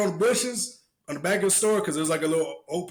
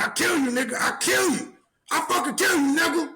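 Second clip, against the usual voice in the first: mock threat shouts; 10 semitones higher than usual. Retelling street punks, yelled mock threats. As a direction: yell it like a punk threatening to kill.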